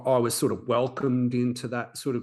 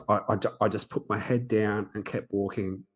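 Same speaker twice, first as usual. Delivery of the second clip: ordinary talk, nothing marked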